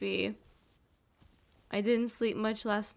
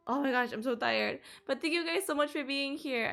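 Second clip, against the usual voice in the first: ordinary delivery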